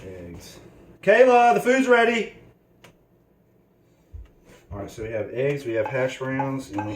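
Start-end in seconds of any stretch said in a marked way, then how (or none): 1.0-2.3 loud high call to another room; yelling across the room. Real, not acted. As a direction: shout it across the house. Calling daughter from another room.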